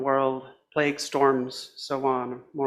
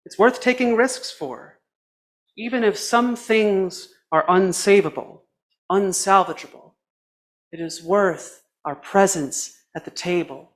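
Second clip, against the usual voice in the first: impassioned preaching; 5 semitones higher, 6 dB louder than usual. Acted. Sermon climax, rising urgency.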